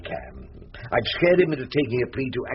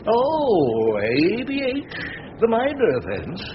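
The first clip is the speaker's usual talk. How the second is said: raised, rising doubtful tone; 9 semitones higher, slower than usual. Acted. Barrister feigning doubt to the other counsel, doubtful. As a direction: sound unsure it would be accepted. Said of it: "I did my best to sound doubtful".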